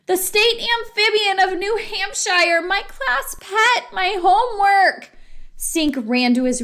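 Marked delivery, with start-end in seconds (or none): 0.0-5.1 high-pitched distraught lament; high, aggrieved cry; wailing. A narrator's reading. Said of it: "cried Stink".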